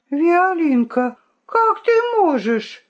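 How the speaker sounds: high, wounded protest; a hurt old rooster pleading with the princess. Acted. Cock hurt by accusation.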